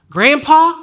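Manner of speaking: put-on high, loud southern-accented caller. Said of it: "a southern kind of hick-ish accent".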